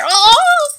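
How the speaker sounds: high, loud "oh"; sharp rising yelp; startled cry. Real, not acted. Reacting to noise in ear.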